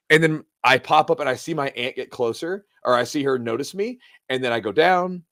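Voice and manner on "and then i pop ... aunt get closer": normal talking voice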